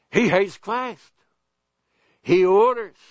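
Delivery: usual speaking voice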